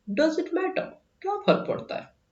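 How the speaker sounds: mock girl voice.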